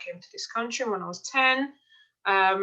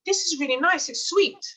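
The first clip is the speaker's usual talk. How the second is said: high, bright delight at the taste; pleasantly surprised, a step higher; 9 semitones higher, 3 dB louder than usual. Retold or imitated. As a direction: gush over how nice it tastes. Tasting granola, delighted.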